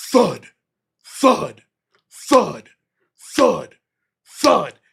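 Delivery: loud, heavy, evenly spaced thuds; imitated thuds. Retelling pounding heard from bed, imitated heavy thuds. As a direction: punch each thud out on its own, with a gap. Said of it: "I just hear this".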